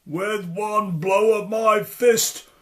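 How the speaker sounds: booming slow threat; roared. Acted. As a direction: roar each word as a threat. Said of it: "roared the giant".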